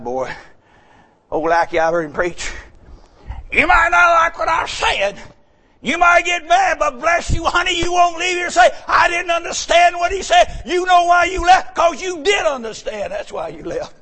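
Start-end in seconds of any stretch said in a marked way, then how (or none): 3.5-13.1 high, loud sermon delivery, relentless; fired-up preaching. Real, not acted. Preacher telling folks they did understand him, fired-up preaching. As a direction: hammer it at the congregation in a raised voice.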